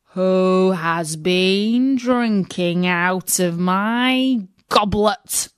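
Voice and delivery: slow, drawn-out dwarf voice; a slow, deliberate dwarf. A narrator's reading. Seventh dwarf asking about his goblet, slow and deliberate.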